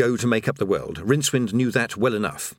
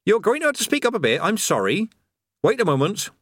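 higher, hurried call over the wind; higher, faster shout; 9 semitones higher than usual. Acted. Creator calling over the noise of the fall. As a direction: speak up over the rushing air.

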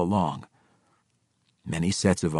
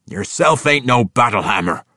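louder gruff jab; scornful dwarf; 7 semitones higher, 8 dB louder than usual. Acted. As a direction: dismiss him. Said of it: "Winko said".

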